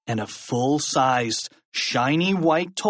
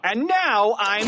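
raised mocking voice; taunting; 9 semitones higher than usual. A narrator's reading. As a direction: start a smug announcement, break off abruptly. Teacher taunts until cut off.

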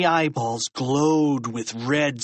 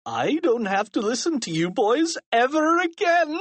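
high gloat exploding into cackles; gleeful principal breaking into maniacal laughter; laughing gloat; 12 semitones higher than usual. A narrator's reading. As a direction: crow it, then burst out laughing. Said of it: "laughed Mister Krupp".

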